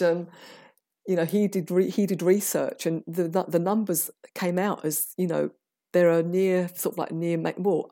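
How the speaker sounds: ordinary talk, nothing marked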